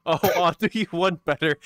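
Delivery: normal talking voice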